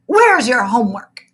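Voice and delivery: loud, curt snap; snapping. A narrator's reading. The strict teacher snapping at his old student, loud. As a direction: snap the question. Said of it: "snapped the fifth grade teacher".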